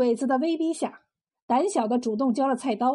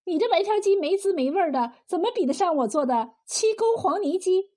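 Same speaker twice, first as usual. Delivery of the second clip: proud woman cook, higher-pitched and quick; 柳三娘，不屑; 5 semitones higher than usual. Acted. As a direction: dismiss his chicken in a higher, brisk voice. (高声快语，不屑又自夸)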